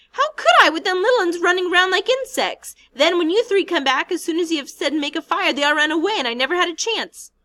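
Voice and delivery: very high, loud outraged cry; indignant cry. Acted. Piggy protesting to Ralph, indignant and shrill. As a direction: fling the question back at him.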